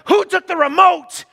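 high, loud angry demand; upset outburst. Acted. Spouse suddenly upset at home, angry shout. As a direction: snap it in sudden irritation. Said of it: "All of a sudden, you're upset".

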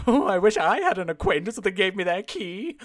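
lightly put-on suggestive busybody voice; hint with hammed-up envy; creepy neighbor. Neighbor envying the gift of a key, creepy voice. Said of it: "But in the creepiest way possible".

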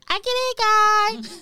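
high, loud, slow cartoon whine; squeaky game-character complaint; Sims character voice. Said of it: "they still wave their hand at you above you like".

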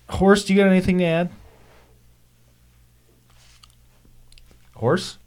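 high-pitched call to a silent co-host. Pretend. Calling on the silent horse.

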